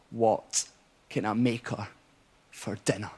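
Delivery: put-on low, soft drunken mumble, long pauses; halting drunk confession, pitched well down; bleary drunk mate. Bleary mate asking Ian for relationship advice, drunken and hushed. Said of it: "bleary-eyed, he's like".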